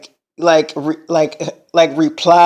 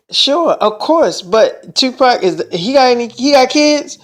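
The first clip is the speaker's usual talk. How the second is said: higher, halting surprised question; retold surprise; 7 semitones higher than usual. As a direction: imitate her stumbling into a puzzled question. Retold startled question about [name]'s kids.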